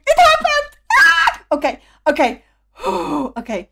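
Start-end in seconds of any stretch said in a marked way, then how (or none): 0.1-1.4 excited shout. Real, not acted. Bursting with joy.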